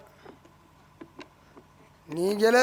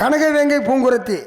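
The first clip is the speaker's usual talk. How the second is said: grand recitation; 3 semitones higher, 4 dB louder, slower than usual. Acted. Grand poetic name recited.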